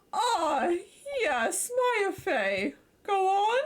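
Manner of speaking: a hint of a blustering elder lawyer greeting a visitor; pompous old lawyer Grossberg. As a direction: puff up like a self-important senior lawyer.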